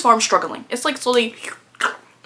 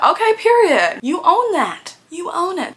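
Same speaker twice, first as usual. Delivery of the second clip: hyped cheer; 8 semitones higher, slower than usual. Retold or imitated. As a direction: cheer her on like a hype girl. Cheering the character on.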